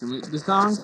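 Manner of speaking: normal talking voice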